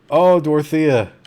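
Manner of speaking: loud, high cry of dismay; distressed cry. Real, not acted. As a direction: cry out for your doomed game character. Game character is caught by zombies.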